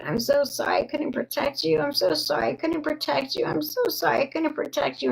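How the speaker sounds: high, rushed retold tearful apology; imitating crying mother. Mother apologising at her daughter's hospital bed, imitated crying. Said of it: "And she was crying".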